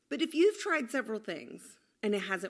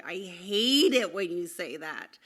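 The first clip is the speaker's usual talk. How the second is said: put-on annoyed teen; 6 dB louder, slower than usual. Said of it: "my seventeen-year-old said".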